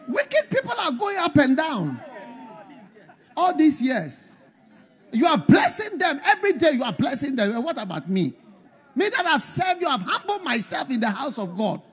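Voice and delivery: loud, high-pitched mock complaint; bitter prayer. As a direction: act out an aggrieved protest to God. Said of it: "'cause you can pray bitterness".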